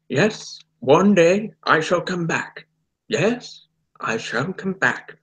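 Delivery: put-on high, stately old Doctor speech; Hartnell impression. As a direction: deliver a solemn, theatrical promise. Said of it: "That's my impression".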